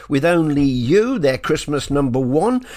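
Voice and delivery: ordinary talk, nothing marked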